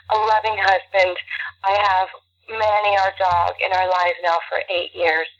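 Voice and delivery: ordinary talk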